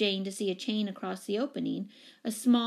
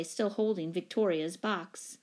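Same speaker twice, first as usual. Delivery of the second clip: plain reading voice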